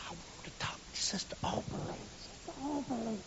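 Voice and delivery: put-on hushed child's whisper; boy whispering to the church lady. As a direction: breathe it secretly.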